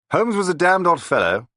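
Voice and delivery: exclaiming. A narrator's reading. Fifth man exclaims to the table, high and loud. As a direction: blurt it out as an odd old officer. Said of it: "a fifth man exclaimed".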